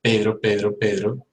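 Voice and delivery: Pedro with an unaspirated P; soft Spanish P. Shown as a demonstration. Teacher drilling the Spanish P. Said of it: "In Spanish".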